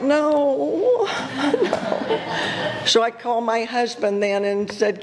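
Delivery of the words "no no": "no" rising high through laughter; laughing refusal. Real, not acted. Preacher laughs off God's call to Mardi Gras, laughing. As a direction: laugh out the nos, half pleading.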